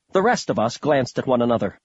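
plain reading voice

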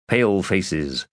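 usual speaking voice

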